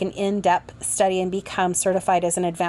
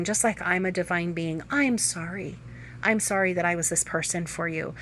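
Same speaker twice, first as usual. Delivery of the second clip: tender prayer; 2 semitones lower, 4 dB quieter than usual. Shown as a demonstration. A teacher voicing the prayer to a hurt person, softly and sincerely. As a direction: murmur it gently as a blessing.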